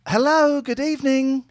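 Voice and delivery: high falsetto lady on the phone. Acted. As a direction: pitch way up like a woman. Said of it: "She was Welsh".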